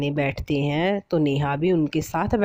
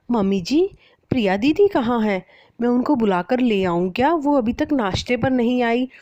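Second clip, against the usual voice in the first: higher, helpful bride voice; lightly voiced bride asking politely; polite young bride; 7 semitones higher than usual. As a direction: offer to fetch her, eager to please. Bride asks about sister-in-law.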